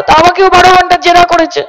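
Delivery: ordinary delivery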